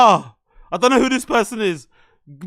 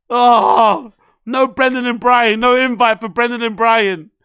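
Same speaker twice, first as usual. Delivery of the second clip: ordinary talk, nothing marked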